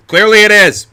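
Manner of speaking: high, loud comic interjection; loud heckling. Real, not acted. Reader answering the heroine's doubt, loud heckling. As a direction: shout back at the story's question.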